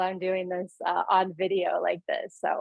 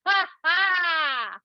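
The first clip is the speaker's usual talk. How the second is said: drawn-out, higher HA HA HAA; big booming laugh; 7 semitones higher than usual. Shown as a demonstration. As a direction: boom out a huge laugh. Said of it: "Big booming laugh".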